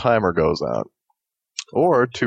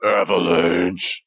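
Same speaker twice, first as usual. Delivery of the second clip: big scary voice; 3 semitones lower, much slower than usual. Pretend. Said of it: "Say in a big scary voice".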